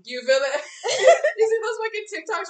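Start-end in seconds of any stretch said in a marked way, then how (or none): 0.6-2.5 high, loud burst of laughter spilling into words. Real, not acted. Laughing at her friend's story, loud and high. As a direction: laugh out loud, then talk through it.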